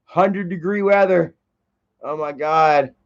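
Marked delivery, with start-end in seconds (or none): none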